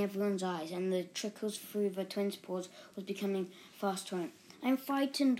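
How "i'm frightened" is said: whimpered. A narrator's reading. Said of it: "whimpered Tony".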